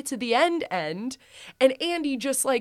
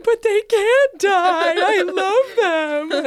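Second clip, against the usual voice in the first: high, loud, slow mock wail; a child protesting the toys' fate, in a hammed-up wail; 9 semitones higher, 6 dB louder, much slower than usual. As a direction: wail it like a heartbroken kid. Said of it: "I'm like".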